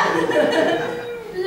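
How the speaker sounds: a real laugh, pitched well up; high burst of laughter. Real, not acted. Recounting the sister-in-law's answer, laughing high. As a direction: crack up at the punchline.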